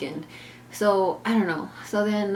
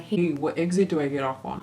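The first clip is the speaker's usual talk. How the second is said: imitating husband; 3 semitones lower than usual. Narrator mimics Justin on the phone, lower.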